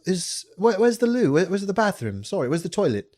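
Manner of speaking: high-pitched put-on British accent. Asking partygoers where the loo is, high British accent. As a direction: play a Brit politely fumbling for the word. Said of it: "same thing".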